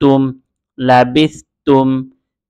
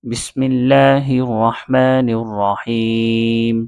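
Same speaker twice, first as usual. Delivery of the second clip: slow, drawn-out Quranic recitation; 2 semitones lower, much slower than usual. Shown as a demonstration. Teacher opening the reading lesson, slow reverent recitation. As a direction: intone it slowly and reverently. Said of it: "শুরু করছি।".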